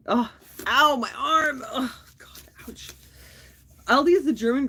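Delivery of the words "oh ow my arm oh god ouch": wincing in pain, voice straining; pained groan. Real, not acted. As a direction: react to a sharp stab of arm pain. Arm pain while unboxing.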